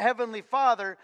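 ordinary talk

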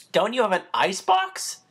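put-on stammering apology from a nervous boy; flustered boy. A narrator's reading. Boy asking, mouth full.

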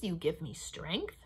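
ordinary delivery, nothing marked

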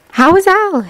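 ordinary delivery, nothing marked

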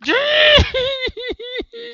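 high shrieked laugh of disbelief; high incredulous shriek-laugh. Real, not acted. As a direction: burst into a squealing laugh. Laughs at dying again.

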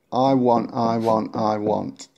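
ordinary delivery

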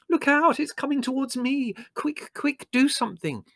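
very high, frantic cry for help; panicked squealing. Acted. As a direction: squeal in terror as it comes at you. Margot panicking as scorpion approaches.

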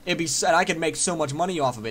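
ordinary talk

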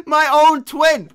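shocked outcry. Real, not acted. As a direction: cry out in betrayed disbelief. Killed by own twin in game.

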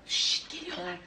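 whispered shush, warning the others; hushed warning. Acted. Gossiping women warn each other in a whisper. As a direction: drop to a whisper, they're coming.

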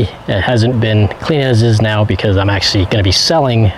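ordinary talk, nothing marked

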